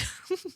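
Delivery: a short high giggle, softly. Real, not acted. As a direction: burst into a little giggle. Laughing at daughter's line.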